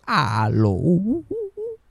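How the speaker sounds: imitación de la abuela, voz que se alarga y sube; abuela imitada. Acted. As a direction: stretch out the hello into rising hoots. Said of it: "la que yo imito cuando digo". (imitación de la abuela alargando el saludo)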